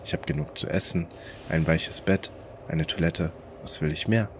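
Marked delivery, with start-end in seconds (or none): none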